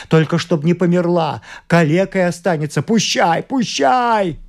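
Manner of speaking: high, escalating frantic plea; desperate cry. A narrator's reading. Father cries that she may live crippled, frantic. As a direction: build to a wailing, high-pitched cry. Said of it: "кричал он в потолок".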